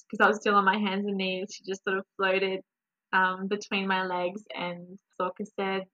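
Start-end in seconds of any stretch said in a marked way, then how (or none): none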